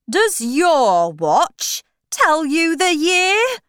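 high, loud, slow eccentric questioning; Mad Hatter. A narrator's reading. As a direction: quiz loudly, stretching the words. Hatter questions Alice, voiced.

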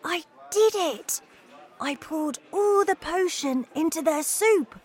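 put-on high, excited little mouse-boy voice; whispering mouse. A narrator's reading. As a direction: report the deed to Grandma in a high-pitched voiced whisper. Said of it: "I whispered".

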